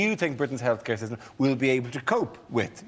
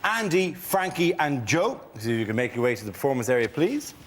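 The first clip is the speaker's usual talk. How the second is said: game show host; 5 semitones higher than usual. Acted. Host announces rounds.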